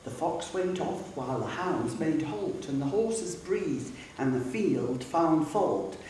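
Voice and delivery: plain reading voice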